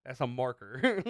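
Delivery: loud mock indignation; retold anger. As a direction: protest loudly, as if mad. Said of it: "I got mad. I was like".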